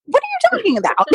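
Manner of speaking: high, incredulous squeak; can't believe what he just asked; sharp confused outburst; baffled disbelief. Retold or imitated. Baffled by brother's odd question.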